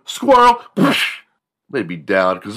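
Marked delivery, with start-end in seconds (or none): 0.0-0.6 much higher, loud yelp of Squirrel; shouted game call. Retold or imitated. Classmate yelling Squirrel before a sack tap. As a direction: yell it out before the hit. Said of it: "sack tap each other".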